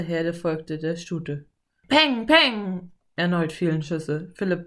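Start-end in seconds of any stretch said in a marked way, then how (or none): 1.9-2.9 loud, high bang-bang; punchy shouted shot noises; imitated gunshots. A narrator's reading. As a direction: fire off spoken gunshots. Narrator voices gunshots.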